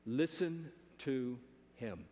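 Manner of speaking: slow, low, solemn command; weighty divine instruction; voice of God. Acted. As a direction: space out each word, low and grave. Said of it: "Then you remember what he said?".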